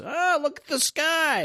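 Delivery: very high, drawn-out cartoon cry; hammed-up falsetto onlooker; aghast giant. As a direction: squeal it slowly in a put-on voice. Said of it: "one guy goes".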